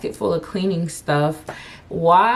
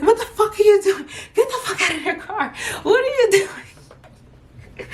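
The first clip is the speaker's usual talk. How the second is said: much higher, laughing mock yell, slowing at the end; high laughing imitation of a yell; mock yelling brother. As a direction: shout at her like the brother.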